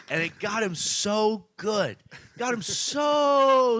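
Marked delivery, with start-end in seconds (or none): 2.4-3.8 drawn out. Real, not acted. Savouring the burn, drawn out.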